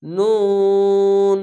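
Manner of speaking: নুন with the u held very long and steady; stretched three alif. Shown as a demonstration. Teacher demonstrating the long three-alif vowel to students. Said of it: "তিন আলিফ টেনে পড়বেন।".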